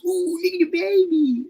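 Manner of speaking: put-on high, slow cooing at a baby. Shown as a demonstration. Showing how everyone coos at babies, high and slow. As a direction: stretch it out high like gushing at a baby. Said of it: "It's always".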